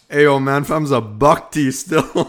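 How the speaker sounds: loud, slow Toronto street slang; Toronto slang accent. Pretend. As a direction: drawl it like a Toronto roadman. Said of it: "I wanna do some Toronto talk".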